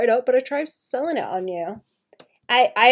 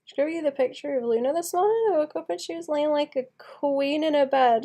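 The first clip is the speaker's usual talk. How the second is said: ordinary talk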